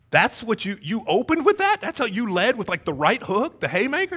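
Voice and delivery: high-pitched retold disbelief; incredulous. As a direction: challenge a friend in amazement. Retold disbelief at friend's blunt approach.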